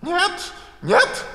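very high, loud refusal; the Queen cutting in with a shrill yell; a high, loud "нет, нет"; shouting no. A narrator's reading. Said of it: "крикнула королева".

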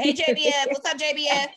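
loud, high mock hello; acted greeting. Host acts out how best friends message, acted greeting. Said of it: "Anytime we message each other".

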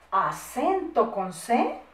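slow, stretched syllables, rising end; measured word, then its spelling; slow dictation. Shown as a demonstration. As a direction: dictate for pupils writing.

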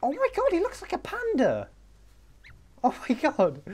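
very high, excited squeal; squealing delight. Real, not acted. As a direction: squeal with delight at something cute. Delighted by the panda.

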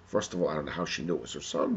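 ordinary delivery, nothing marked

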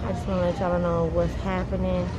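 muffled behind cloth mask; speaking with mouth covered; through a face mask. Real, not acted. Seen 1: Cloth mask covers mouth and nose throughout; refs show it under chin.

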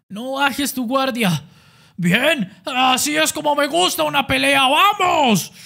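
high, loud taunting; cocky boxer relishing the fight; cocky fighter. Acted. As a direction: goad the opponent, enjoying the brawl.

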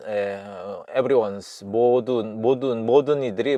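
normal talking voice

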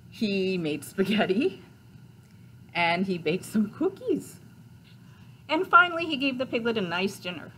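bouncy read-aloud with emphatic lift; story narration. A narrator's reading. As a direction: build the list for young listeners. Reads picture book aloud.

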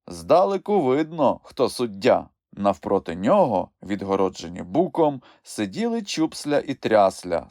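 usual speaking voice

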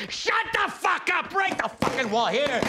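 high, slow, furious shout; angry yell. Acted. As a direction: bark it at the stage, drawn out. Yelling at fake actor.

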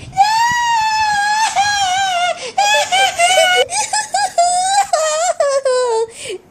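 very long high-pitched fake wail; pretend bawling. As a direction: keep wailing, breaking into sobs. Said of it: "don't cry".

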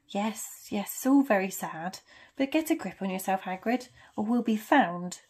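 ordinary delivery

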